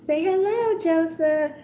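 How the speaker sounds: high, soft pet voice; lilting voice for the kitten. Real, not acted. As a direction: coo the kitten's name. Talking to the kitten.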